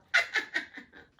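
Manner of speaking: breathy unvoiced laugh; a real little laugh. Real, not acted. Reader laughs at the compliment.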